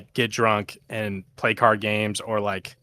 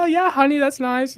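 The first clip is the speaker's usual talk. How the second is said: much higher, sweet mom voice; imitating mom; 4 dB louder than usual. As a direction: play mom humoring her kid. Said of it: "She's like".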